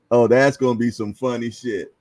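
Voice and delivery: ordinary talk, nothing marked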